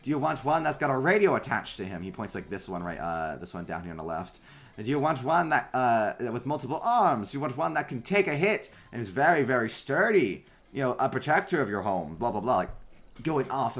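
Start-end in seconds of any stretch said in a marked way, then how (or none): 0.0-2.0 salesman voice. Acted. Tinker's sales pitch. 4.8-12.5 salesman voice. Acted. Tinker's sales pitch.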